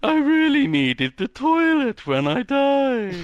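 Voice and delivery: high, slow ghostly voice. Acted. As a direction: play a childlike little ghost. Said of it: "I like his ghost voice".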